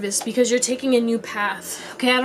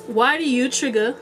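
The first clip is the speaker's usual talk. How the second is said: normal talking voice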